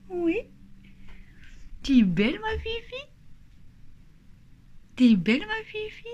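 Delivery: high, soft cooing to her cat; pet talk. Real, not acted. Woman cooing at her cat in a high voice.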